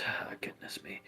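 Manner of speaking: breathy whispered aside. Acted. The headless man whispering to Andrew Fry. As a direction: whisper it like a ghost. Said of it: "he just goes".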